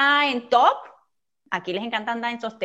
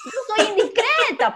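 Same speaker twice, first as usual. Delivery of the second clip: said through a high laugh; real laughing admission; 11 semitones higher, 4 dB louder, slower than usual. Real, not acted. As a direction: laugh it out, admitting it. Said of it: "laughs at own bluntness".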